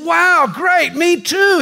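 high thrilled exclamation; delighted, pitch it way up; excited. Acted. Rescuer thrilled they share a faith, high excited cry.

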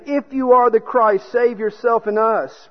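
loud, high-pitched mild taunt; blaspheming taunt. A narrator's reading. Preacher voicing a criminal challenging Christ, mildly taunting. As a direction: throw out the challenge forcefully, as a read quote. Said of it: "who were hanged blasphemed him".

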